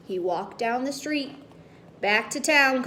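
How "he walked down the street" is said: ordinary delivery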